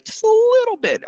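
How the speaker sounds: very high squeaky little voice; teeny-tiny falsetto; tiny amount. Shown as a demonstration. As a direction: squeeze it up high, as if pinching something tiny.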